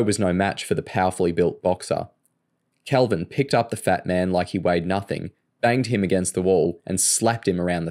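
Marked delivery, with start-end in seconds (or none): none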